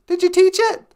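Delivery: put-on high, loud old lady voice; grandma character voice. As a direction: chirp it like a sweet old granny. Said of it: "Nana Bonjour".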